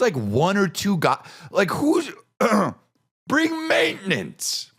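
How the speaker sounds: high, building disbelief to a shout; incredulous rant. Real, not acted. Comedian's rant about maintenance.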